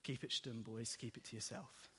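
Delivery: low, hushed caution to friends; quiet warning. Acted. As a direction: keep it down, as if sharing a secret. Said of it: "Jesus warns them".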